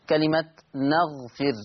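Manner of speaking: نغفر with open nun, slow; نغفر read the Hafs way, slow and deliberate. Shown as a demonstration. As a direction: say نغفر slowly, fatha on the nun. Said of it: "حفص عن عاصم يقرأ بفتح النون".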